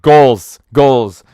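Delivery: loud emphatic call; hyped shout. Real, not acted. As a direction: punch the word.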